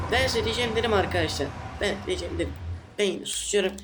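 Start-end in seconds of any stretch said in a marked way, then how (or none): none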